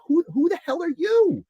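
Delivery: high, incredulous who the hell are you; mock outrage at the pitcher; indignant outburst. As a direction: fire the question back in disbelief. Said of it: "it's like".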